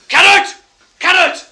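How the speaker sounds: high, loud repeated yell; short, piercing wake-up shouts; screw's shout. Retold or imitated. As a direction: bellow it down the cells. Acted screw waking him.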